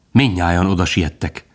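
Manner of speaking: ordinary delivery, nothing marked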